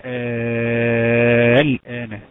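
الآن with the first «آ» held very long and loud; madd elongation. Shown as a demonstration. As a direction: chant الآن, sustaining the opening vowel. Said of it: "طيب مثال ايه؟". (مدّ طويل مرتّل في أول الآن)

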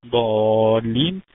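ضالين with a long, loud held «ا»; madd elongation. Shown as a demonstration. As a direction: stretch the alif in ضالين. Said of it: "مد لازم كلمة مثقل". (إطالة الألف في ضالين)

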